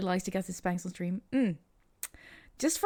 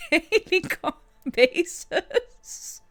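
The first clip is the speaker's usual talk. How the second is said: higher, slow words broken up by laughter; laughing; 8 semitones higher, 5 dB louder, much slower than usual. Real, not acted. Streamer teasing the chat about daily talks, laughing. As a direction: fight through laughter to finish the phrase. Cracks up while making a remark.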